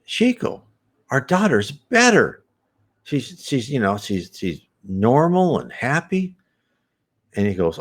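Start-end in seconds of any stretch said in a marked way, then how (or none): 0.0-6.4 much higher, relieved parent voice; a hammed-up glad parent, voiced high; parents' happy report. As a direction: tell Chico the good news, lifted and glad. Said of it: "And they said".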